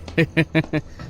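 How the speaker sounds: high, choppy giggle; taunting cackle. Acted. Rowdy laughing while chasing a girl, taunting cackle. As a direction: cackle at your own jeer.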